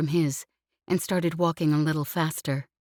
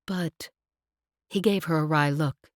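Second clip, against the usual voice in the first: lowered man voice; 4 semitones lower than usual. A narrator's reading. Lev voiced, low whispering.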